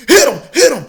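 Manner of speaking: loud, high urging shout; cheering yell. Retold or imitated. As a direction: egg the fighter on. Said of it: "the drummer said".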